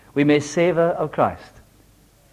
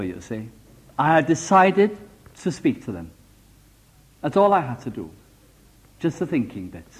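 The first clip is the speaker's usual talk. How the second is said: ordinary talk, nothing marked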